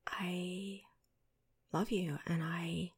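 whispering. Acted. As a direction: whisper it close and intimate.

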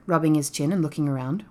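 plain reading voice

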